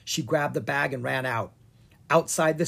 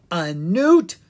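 incredulous; 4 semitones higher, 8 dB louder than usual. Acted. Wizard repeating in disbelief.

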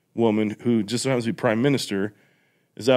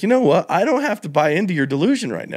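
mock enlightened declaration; 6 semitones higher than usual. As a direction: announce it like a breezy sage. Acting the first enlightened man.

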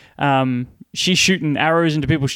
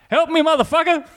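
mock call for help; 13 semitones higher than usual. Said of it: "I'll be like".

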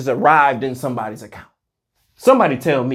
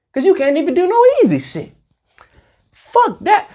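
squeaky outburst; 14 semitones higher than usual. Real, not acted. Ranting at haters.

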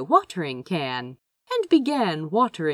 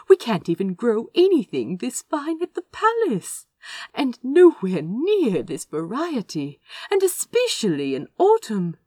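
higher, louder, gushing delight; 7 semitones higher, 5 dB louder than usual. Acted. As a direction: gush over every flower. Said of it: "He pointed delightedly".

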